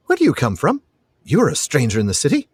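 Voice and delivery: put-on high boyish voice; young lad. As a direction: question a stranger as a nosy boy. Reads the lad's dialogue.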